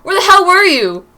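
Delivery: loud furious shout at her; angry yell. Acted. Angry boyfriend yelling at Mary for coming home late. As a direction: yell it at her the moment she walks in.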